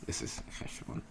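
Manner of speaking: This is cheshbon whispered under the breath. Shown as a demonstration. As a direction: breathe it out as an aside. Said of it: "computation or account".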